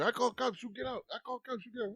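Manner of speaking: high, repetitive mock threat; agitated store owner. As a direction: imitate a shopkeeper throwing kids out, high-pitched. Store owner ordering kids out.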